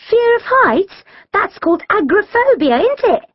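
put-on high-pitched, inquisitive girl's voice; questioning. A narrator's reading. Shirelle checks the phobia's name with friends, questioning. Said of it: "Shirelle asked".

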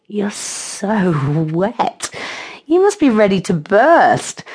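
slow, drawn-out excited exclamation; exclaiming. A narrator's reading. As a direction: exclaim it slowly, leaning on the key words. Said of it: "Mel exclaimed".

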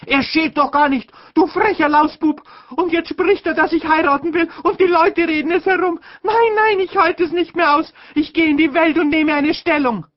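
very high, loud tearful shriek; screaming sister. A narrator's reading. Sister screaming at her brother, very high and loud. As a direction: scream it in hysterical despair. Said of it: "mich angeschrien".

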